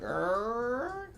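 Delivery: high rising drawn-out "errr"; imitated horror-movie creak. Shown as a demonstration. Host showing how horror movies start, high creaky noise. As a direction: creak upward like an old hinge. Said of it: "it's like".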